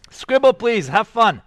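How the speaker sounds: rally the class to start; calling out. Real, not acted. Teacher sending students off to scribble, cheerful loud call.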